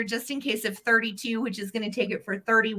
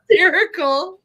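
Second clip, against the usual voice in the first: high, loud laugh breaking into the word; 11 semitones higher, 7 dB louder than usual. Real, not acted. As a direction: laugh through the word hysterical. Laughing at a funny book.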